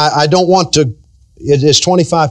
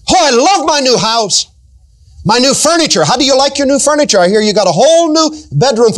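gushing mock delight; 7 semitones higher than usual. Mimicking neighbours' gushing.

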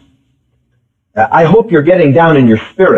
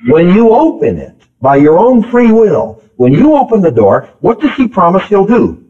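ordinary talk

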